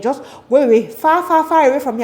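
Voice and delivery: loud, high mock panic; alarmed dismissal. Girl sending away boy she fears pregnancy from, alarmed dismissal. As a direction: shoo him off with hammed-up alarm.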